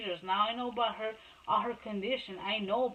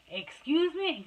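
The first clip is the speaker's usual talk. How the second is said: retold affront, pitched up high; indignant disbelief; 7 semitones higher, 4 dB louder, slower than usual. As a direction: act out an offended challenge. Mother retells being accused.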